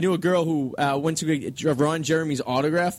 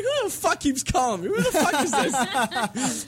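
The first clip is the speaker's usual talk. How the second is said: laughing while quoting; 6 semitones higher, much slower than usual. Retold or imitated. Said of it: "He's like".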